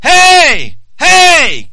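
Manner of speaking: loud, high repeated holler; crying out. Acted. God crying out your name to you, loud high call. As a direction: holler it out like hailing someone far away. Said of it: "he's crying it out".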